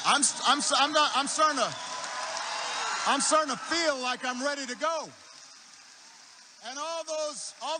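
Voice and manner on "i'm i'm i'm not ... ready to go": loud, high, stammering build-up; rousing excitement, raised voice; getting fired up. Retold or imitated. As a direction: work yourself up, stumbling over the start. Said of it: "starting to get kinda fired up".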